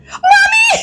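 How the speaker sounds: put-on piercing high child's call; child's cry. Scared daughter calls to her mother, crying out. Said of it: "Melda cried".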